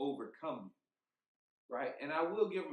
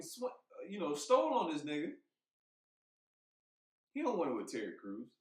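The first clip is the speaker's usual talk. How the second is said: ordinary talk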